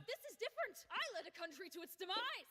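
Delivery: high-pitched, outraged defence; indignant protest. Acted. Heated argument over who is worse.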